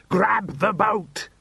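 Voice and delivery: slow high yell. A narrator's reading. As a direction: yell it together, drawn out. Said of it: "they both yelled".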